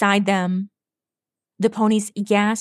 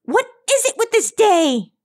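high, strained cry of frustration; exasperated shout; 12 semitones higher, 4 dB louder than usual. A narrator's reading. Rainbow at her wits' end as she dives, exasperated shout. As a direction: wail it, completely fed up. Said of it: "Rainbow shouted at her wits' end".

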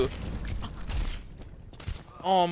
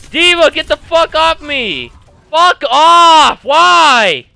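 loud, high frustrated shout; exasperated yell at an attacker; real gamer rage; 6 semitones higher, 10 dB louder, slower than usual. Real, not acted. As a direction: yell it at the enemy, wailing the last words. Enraged at game opponent.

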